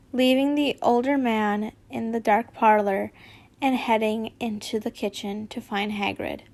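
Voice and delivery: plain reading voice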